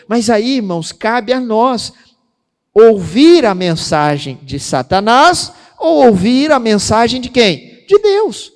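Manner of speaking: prega devagar e forte, subindo no fim; pregação inflamada. Acted. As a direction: chama a igreja a responder. Pregação com força. (pastor prega à igreja com força)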